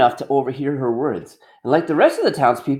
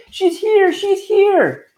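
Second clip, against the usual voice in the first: much higher excited call; shouting lookout; 3 dB louder, much slower than usual. Acted. Watch Wemmick announcing her arrival, high excited shout. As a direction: call out from the hilltop.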